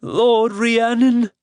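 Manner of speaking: a servant cringing before his god; whimpering awe. Acted. Bagaz hails Rhiannon in feigned dread, slow and high. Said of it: "Bagaz whimpered again".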